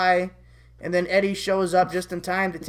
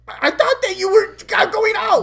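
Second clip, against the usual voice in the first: much higher, louder, stammering bewilderment; shocked disbelief; 14 semitones higher, 8 dB louder than usual. Acted. Eddie learning they weren't dating, loud baffled outburst. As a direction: blurt it out in confusion.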